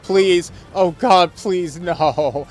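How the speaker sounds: high loud mock pleading; anguished denial. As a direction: beg with a laugh in it. Begging continues, laughing in between.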